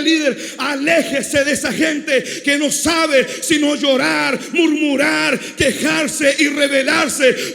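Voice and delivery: shouted, driving sermon delivery; preaching at full force. Acted. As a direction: preach it hard to the congregation. (predicando a todo pulmón)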